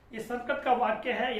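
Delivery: ordinary delivery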